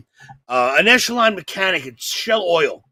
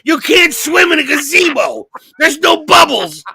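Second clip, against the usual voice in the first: loud, higher incredulous rant; mock outrage; 8 semitones higher, 5 dB louder than usual. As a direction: shout like a betrayed kid.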